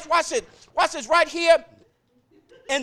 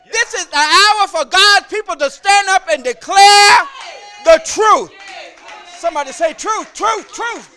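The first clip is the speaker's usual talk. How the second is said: shouted preaching climax; 6 semitones higher, 10 dB louder than usual. Acted. Preacher urging the church to declare truth, loud shout. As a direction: declare it like a trumpet call.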